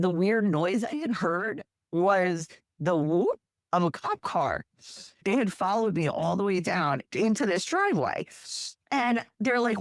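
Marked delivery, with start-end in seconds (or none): none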